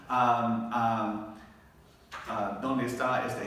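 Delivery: ordinary delivery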